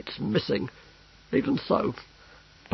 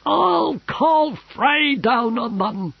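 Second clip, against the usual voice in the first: high, loud, slow threat; a spoiled ruler's tantrum; petulant shout; 6 dB louder, much slower than usual. A narrator's reading. The Emperor threatening enemies, high loud shout.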